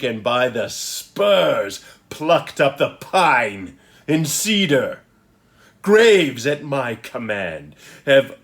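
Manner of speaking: loud, commanding Prospero; powerful sorcerer proclaiming his magic; Shakespearean declaiming. Acted. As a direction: declaim with stretched, weighty words and big pauses. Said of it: "So let me give it a read".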